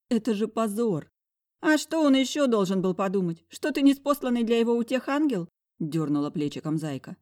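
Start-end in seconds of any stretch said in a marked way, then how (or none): 1.7-5.5 high, loud mocking friend. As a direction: scoff at the question.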